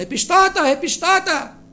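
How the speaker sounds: high, loud pleading call; crying out loud. Retold or imitated. Preacher voicing lepers crying to Jesus, high and loud. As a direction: beg for mercy from afar. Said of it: "φωνάζανε".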